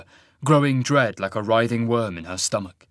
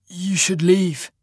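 raised, slow rasp; rasped warning; 7 semitones higher, slower than usual. A narrator's reading. Cole warning Sasha away from the ruined temple, rasped. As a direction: rasp it out slowly and higher. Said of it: "he rasped".

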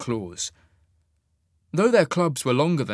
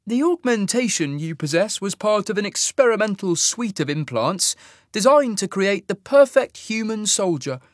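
much higher, measured, detached explanation; cool alien officer; 8 semitones higher, 4 dB louder than usual. Acted. Fade officer explaining to Sasha, cool and much higher. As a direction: lecture it with calm detachment.

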